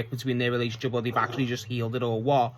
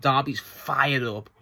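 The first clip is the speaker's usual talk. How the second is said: ordinary talk, nothing marked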